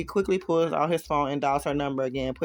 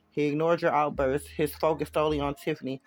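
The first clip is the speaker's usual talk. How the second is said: ordinary delivery, nothing marked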